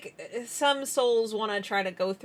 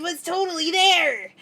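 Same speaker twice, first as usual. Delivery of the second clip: creepy character voice; 6 semitones higher, 5 dB louder than usual. Acted. Continuing the creepy character bit.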